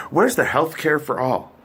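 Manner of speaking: normal talking voice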